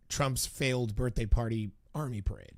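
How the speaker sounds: ordinary talk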